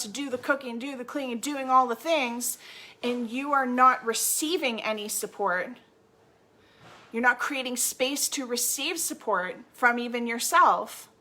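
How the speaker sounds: ordinary delivery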